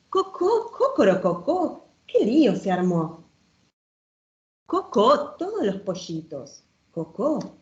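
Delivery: playful, sing-song read-aloud with bouncing rhyme; reading to children. Acted. Showing a children's book to the audience, lively storytime reading.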